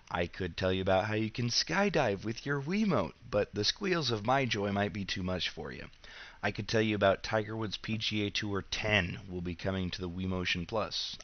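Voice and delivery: usual speaking voice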